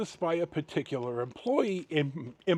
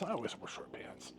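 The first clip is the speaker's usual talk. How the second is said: whispered aside; 3 semitones higher, 13 dB quieter than usual. Real, not acted. Aside about his clothes.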